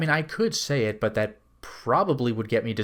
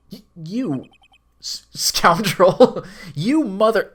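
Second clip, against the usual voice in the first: loud, high, stammering insult; hammed-up outrage; 5 semitones higher, 7 dB louder, slower than usual. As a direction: drag out a mock-furious cry, cracking into laughter. Lawyer insults the threatening villain.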